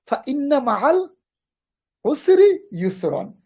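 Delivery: Quran recitation. Acted.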